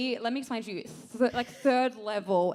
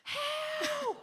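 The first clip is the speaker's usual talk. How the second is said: high-pitched mock scream for help; retold scream. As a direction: cry out shrilly, without full volume. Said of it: "and I just scream".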